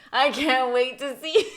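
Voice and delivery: loud, drawn-out gleeful repeat; mock eagerness. Mocks own earlier eager thought.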